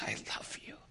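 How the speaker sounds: hushed, loving quote; barely voiced endearment; tender whisper. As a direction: drop suddenly to a soft whisper. Story's tender closing words.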